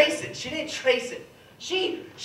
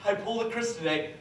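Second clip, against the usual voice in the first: imitated dad; 4 semitones lower, 7 dB quieter than usual. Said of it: "my dad would be like".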